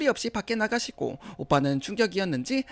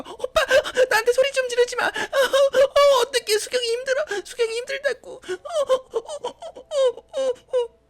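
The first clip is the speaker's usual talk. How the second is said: very high sobbing whine, loud; whiny fake crying; 6 dB louder than usual. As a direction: wail and whimper to the brother. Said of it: "울더라고요".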